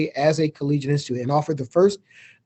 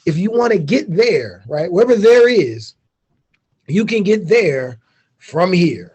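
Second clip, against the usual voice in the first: slow, louder pitchman read; mock commercial; 3 semitones higher, 5 dB louder, slower than usual. President pitching his university to students, slow ad voice. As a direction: sell the school like a TV ad, drawn out. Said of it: "So that's my V S U commercial".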